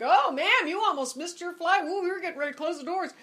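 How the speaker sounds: flustered flight attendant. Retold or imitated. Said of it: "and they're like".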